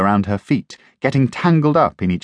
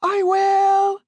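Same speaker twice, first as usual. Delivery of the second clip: high bright call; girl calling back to her grandad. Acted. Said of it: "Jackie called back".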